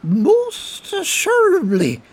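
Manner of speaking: put-on very high, slow sugary drunk; sweet drunk assurance. Colm soothing the soldier, high and sugary. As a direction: smile it out sweetly. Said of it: "Colm smiled back sweetly".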